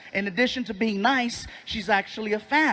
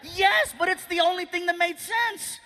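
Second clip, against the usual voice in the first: high-pitched defensive protest; defensive retort; 8 semitones higher than usual. Acted. Comic answering the audience doubter, high defensive protest. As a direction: protest back in a much higher voice.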